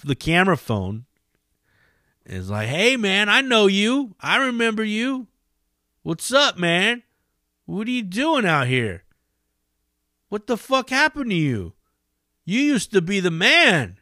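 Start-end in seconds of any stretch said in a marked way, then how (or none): 2.7-11.7 high, jeering mock-friendly voice; mocking stranger. Cameraman taunting a homeless man, high mocking voice. 12.4-14.0 a bit higher mocking jab; taunting cameraman. Cameraman needling the homeless man, lightly high taunt. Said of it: "he starts shitting on him".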